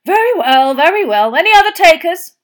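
loud, high booming tout; hearty Bagman. Acted. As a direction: shrug it off and call for bets. Bagman recovering, touting.

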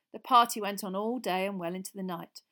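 usual speaking voice

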